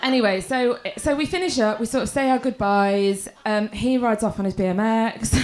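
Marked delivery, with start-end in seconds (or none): none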